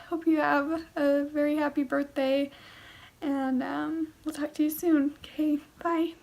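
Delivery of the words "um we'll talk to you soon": ordinary delivery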